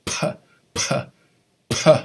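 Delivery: p popped hard as an ejective. Shown as a demonstration. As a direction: punch the p like you're mad. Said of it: "That's gonna be an ejective".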